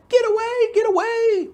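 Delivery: high, loud frantic scream to drive him off; panicked screaming. Retold or imitated. Naked women in the pond screaming at the approaching farmer, frantic high loud scream. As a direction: scream it like a startled woman in the water. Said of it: "they're now yelling and screaming at him".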